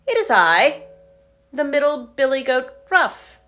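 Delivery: a hint of a plucky young goat; middle billy goat. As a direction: announce yourself bravely to the troll. Middle goat answers troll.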